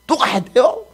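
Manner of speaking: high-pitched whiny grumbling; complaining. Acted. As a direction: whine in protest like grumbling followers. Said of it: "뭐만 하려고 하면 불평하고".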